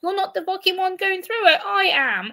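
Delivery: re-enacted exasperated protest; indignant retort. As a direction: act out snapping back at useless advice. Acted retort to calm-down advice.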